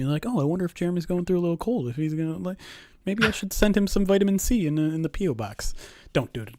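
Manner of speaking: usual speaking voice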